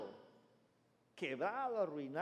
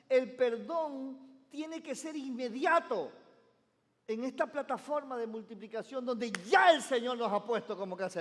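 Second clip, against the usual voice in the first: preaching at full force; 5 semitones higher, 8 dB louder than usual. Acted. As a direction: drive the urgency home to the church. Preaching about forgiveness. (predicación enérgica y fuerte)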